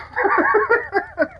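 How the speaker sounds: short high cackle; quick squeal of laughter. Real, not acted. Laughing at retold joke.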